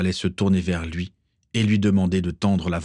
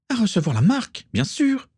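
high-pitched indignant retort; exasperated woman's outburst; angry. A narrator's reading. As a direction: snap back angrily, voice leaping up high. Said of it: "dit-elle avec colère".

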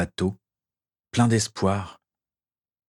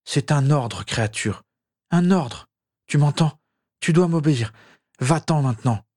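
a touch of stern command, rising on "un ordre"; commanding order; 6 semitones higher than usual. A narrator's reading. Dying wizard ordering his elf away, mildly stern. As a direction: order him away, insistent.

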